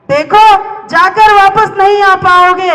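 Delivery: high, slow, ringing declamation; declaimed warning. Acted. Warning there is no coming back, slow high declamation. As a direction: draw it out high and forceful.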